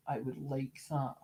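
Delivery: softly, almost to himself, I would like that; hushed, wistful I would like that; quiet repeat. Retold or imitated. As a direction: murmur I would like that dreamily. Imagined collector's wish.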